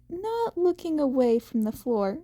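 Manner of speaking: ordinary delivery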